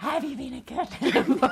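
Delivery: fast, loud mock-sinister Santa question; hammed-up menacing Santa; creepy Santa. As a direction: tease a child as a villainous Santa. Playing evil Santa to child.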